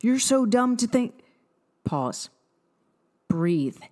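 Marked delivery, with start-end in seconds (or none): 0.0-1.1 a higher, fast, scornful inner critic; berating inner voice. Retold or imitated. The old inner voice mocking her for eating a donut, scornful. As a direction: sneer at yourself like the old voice. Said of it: "that old voice starts kicking in".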